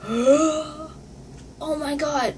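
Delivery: high, slow, drawn-out amazement; gushing, stretched-out awe; starstruck. Retold or imitated. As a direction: act out being starstruck. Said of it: "I was just so starstruck".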